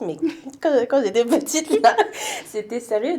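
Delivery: giggling as she recalls it; laughing. Real, not acted. Laughing about mother's accent. (voix haute et rieuse)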